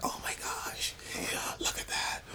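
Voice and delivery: breathless whisper, oh my gosh; awed whisper. Retold or imitated. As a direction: whisper it like you can't believe your eyes. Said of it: "they were like".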